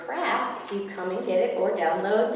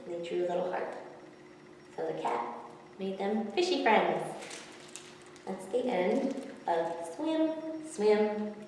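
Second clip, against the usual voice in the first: plain reading voice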